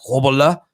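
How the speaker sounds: a throaty Afrikaans-style Grobbelaar; Grobbelaar the original way. Retold or imitated. Host retells how Grobbelaar really says his name. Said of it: "the actual pronunciation of his name was something like".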